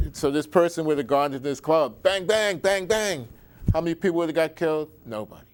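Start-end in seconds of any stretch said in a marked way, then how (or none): 2.0-3.3 mock gunshots. Imitating gunshots at a club.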